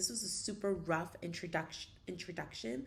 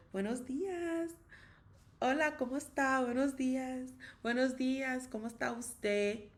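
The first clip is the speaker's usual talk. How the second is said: emphatic feminine; 6 semitones higher than usual. Shown as a demonstration. Teacher showing learners the gendered, expressive way to greet. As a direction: sing out the greeting with your whole body. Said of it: "you try to put your whole body into it".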